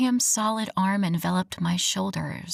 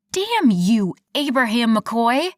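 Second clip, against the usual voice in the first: loud exasperated outburst; angry; 4 semitones higher, 6 dB louder than usual. Acted. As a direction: blow up at him. Stomps foot, furious at teasing.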